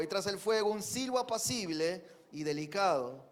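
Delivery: loud, emphatic Bible reading to the congregation; scripture reading. Acted. Preacher proclaiming scripture loudly to his congregation. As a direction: proclaim the verses with force.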